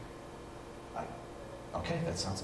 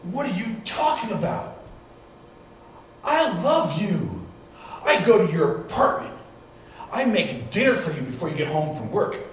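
mock-indignant outburst, higher and louder; upset fiancé; 9 semitones higher, 6 dB louder than usual. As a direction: play the hurt fiancé protesting, all pitched up. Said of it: "He goes".

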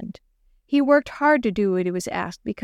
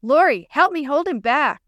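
high, raised-voice plea; shouting; 6 semitones higher, 4 dB louder than usual. A narrator's reading. Jenny to dog and friend as leash pulls, shouting. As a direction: call out urgently, a storyteller's contained shout. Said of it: "Jenny shouted".

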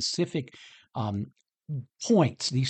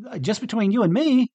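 higher and louder, pressing the point; emphatic confession; 7 semitones higher, 5 dB louder than usual. Retold or imitated. Pastor speaking to Jesus himself, higher and louder. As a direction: say it out loud, no hiding.

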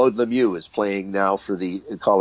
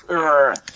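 frustrated growl; 5 semitones higher than usual. Retold or imitated. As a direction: growl out your leftover fury. Still fuming in dressing room.

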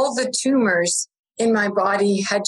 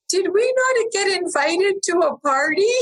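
puzzled surprise; 9 semitones higher than usual. Retold or imitated. Seeing balloons, retold surprise.

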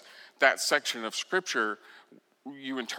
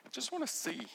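first plea; 10 semitones higher, 8 dB quieter, faster than usual. Acted. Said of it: "Bartimaeus answers".

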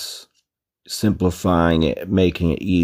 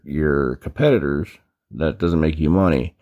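ordinary delivery

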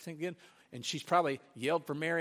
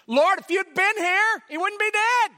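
reproachful; 12 semitones higher, 11 dB louder than usual. Retold or imitated. Martha scolding Jesus over her dead brother. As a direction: blame him to his face.

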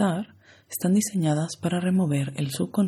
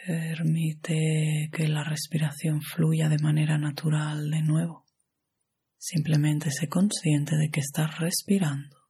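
guided meditation; 2 semitones higher than usual. Acted. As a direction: guide the listener into relaxation, unhurried and even.

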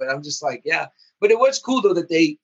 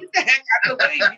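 higher, fast, hammed-up frustration; mock exasperated yell; 9 semitones higher, faster than usual. Fan venting at pesky mutants, mock exasperated yell. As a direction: shoo them off like a fed-up fan.

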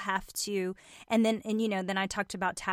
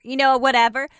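ordinary delivery

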